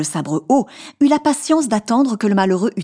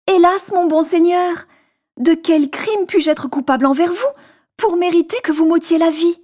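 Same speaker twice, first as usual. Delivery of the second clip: much higher, frightened pleading merchant; terrified merchant pleading with the genie, much higher; trembling plea; 11 semitones higher, 3 dB louder than usual. Acted. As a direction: beg for your life, voice pitched way up. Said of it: "lui répondit en tremblant".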